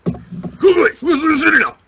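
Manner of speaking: high, loud dubbed kung fu master; hammed-up kung fu dub shout; kung fu movie dub shout. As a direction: bellow it like a badly dubbed martial arts film.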